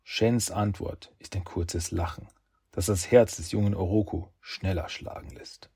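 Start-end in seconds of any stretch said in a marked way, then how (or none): none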